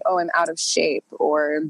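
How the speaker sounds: normal talking voice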